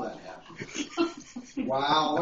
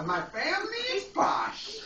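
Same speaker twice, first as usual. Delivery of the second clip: posh; 13 semitones higher than usual. Acted. Posh reading, raised pitch.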